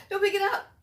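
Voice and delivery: high, loud urgent warning after a gasp; alarmed warning. Real, not acted. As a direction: warn them off in sudden alarm. Warns character handling unstable dynamite.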